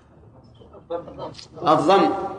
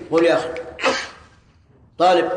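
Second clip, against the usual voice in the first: normal talking voice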